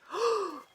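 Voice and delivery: sharp high gasp of fright; frightened gasp. Acted. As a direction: gasp at the hawk. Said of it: "Cyril gasped".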